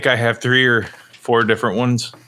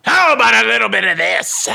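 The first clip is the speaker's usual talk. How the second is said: villainous voice; 11 semitones higher than usual. Acted. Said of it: "That was very villainous".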